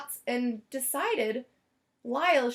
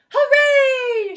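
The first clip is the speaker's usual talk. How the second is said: high, loud cheer; shouted hooray; 12 semitones higher, 12 dB louder than usual. Acted. The Prims cheering Lyle's heroism, loud and joyful. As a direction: cheer it out with joy. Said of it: "shouted the Prims".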